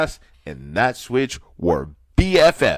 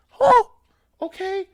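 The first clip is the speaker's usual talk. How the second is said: much higher, startled squeak of disbelief; squeaky shocked huh, then a meek okay; 5 dB louder than usual. Retold or imitated. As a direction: yelp it out like a panicked kid. Child told pants to ankles.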